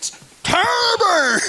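sudden high, loud shout; mock shout. Preacher teasing the congregation with a mock shouted word. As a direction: blurt the word out in a comic yell.

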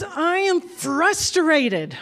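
hands on hips, announcing it with a laugh; mock toddler declaration. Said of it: "But he goes".

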